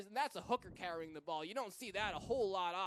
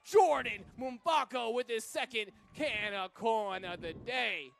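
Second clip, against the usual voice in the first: loud, slow announcer flourish, falling at the end; triumphant scorer call; 7 dB louder, slower than usual. Real, not acted. Commentator hailing the try scorer, triumphant. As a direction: draw out the scorer's name with relish.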